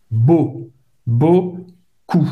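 "beaucoup" with the "eau" as O and a silent P; French vowel demo. Shown as a demonstration. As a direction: split "Bo" off, then say "beaucoup" as "bo-ku". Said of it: "E a U tem o som do O". ("beaucoup" pronunciado "bôcu")

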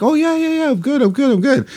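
very high mock-cheery imitation; over-insistent reassurance. Guest insisting to her friend she's fine, over-insistent reassurance. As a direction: squeak out repeated assurances in a put-on high voice.

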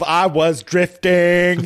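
mock Southern drawl, slow. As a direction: drag it out like the movie. Quoting the film's drawl.

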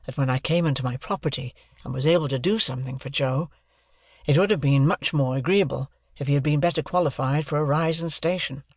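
ordinary delivery, nothing marked

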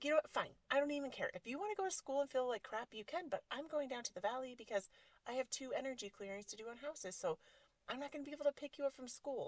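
exasperated mom giving up the fight; reenacted heated scolding; fed-up scolding. Retold argument, fed-up mother scolding.

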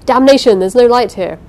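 loud cursing outburst; a man cursing in the dark; angry shout. A narrator's reading. Pursuers searching dark stairs.

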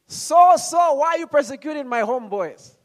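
loud, high booming call; voice from heaven. Acted. Preacher misquoting scripture to the congregation, loud heavenly call. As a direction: proclaim it from on high. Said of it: "heard a voice saying to him".

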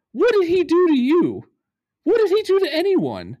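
high, exasperated questions; indignant rant. Real, not acted. Podcast host venting at the player, outraged and high. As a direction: demand an answer, outraged. Ranting about a dirty hit.

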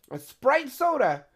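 high, slow, drawn-out outrage; hammed-up indignation at the order; indignant exclaim. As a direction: stretch it out in disbelief. Retelling wrong fast food order.

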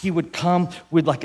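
ordinary talk

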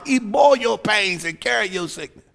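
ordinary talk

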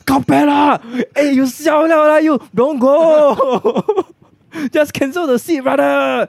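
high, loud shocked outburst; shocked shout. Real, not acted. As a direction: yell it out in disbelief. Friend shocked at pricey wedding.

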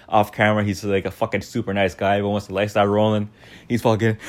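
ordinary talk

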